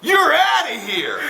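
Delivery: slow, high called-out you're outta here; referee ejection. Retold or imitated. Referee ejecting the coach, slow high call. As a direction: throw him out like a ref. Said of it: "the ref turns around and goes".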